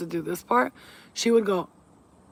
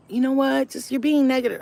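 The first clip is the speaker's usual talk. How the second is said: higher, louder imitation of a complainer; mock objection; 7 semitones higher, 5 dB louder than usual. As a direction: mimic someone calling you out. Mimics people who object to readings.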